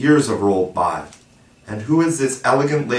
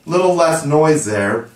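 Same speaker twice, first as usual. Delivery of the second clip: calling out; 6 semitones higher, 5 dB louder than usual. A narrator's reading. Peter quieting the boys for fair play: calling out. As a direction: raise the voice over the boys. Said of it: "Peter called out".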